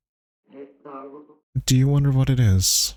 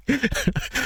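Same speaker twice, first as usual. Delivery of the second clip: rueful laugh through the words; 5 dB quieter than usual. Real, not acted. Streamer jokes to chat about crying, laughing. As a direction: laugh it off, then a breathy giggle.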